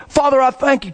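high, projected prayer in imitation; yelled prayer. Man praying across the lane for driver, yelling. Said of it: "Yelling across the lane".

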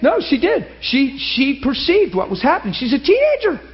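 very high, loud protesting voices; mock crowd voice. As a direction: pipe up for the crowd, insisting. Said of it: "They're all like".

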